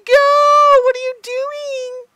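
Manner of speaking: high, loud mock yell at herself; imagined viewer yell. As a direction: shout like impatient viewers. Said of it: "You guys are probably yelling at me".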